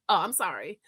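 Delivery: normal talking voice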